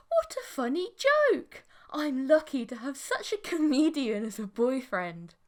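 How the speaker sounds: high squealing mock-sweet gush; gushing delight. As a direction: play it sickly sweet and thrilled. Alien girlfriend gushes playfully.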